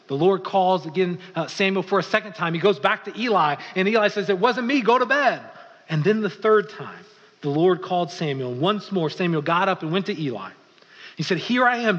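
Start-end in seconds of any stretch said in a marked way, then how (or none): 4.3-5.4 higher, louder brush-off; raised-voice dismissal of a child; dismissive Eli. Retold or imitated. Eli sends the boy back. 11.4-12.0 high, loud eager reply; raised voice, reporting for duty; calling out in answer. Retold or imitated. Samuel answers the call.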